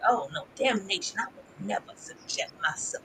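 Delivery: a breathy prim refusal; prim mock refusal. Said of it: "But it's just like".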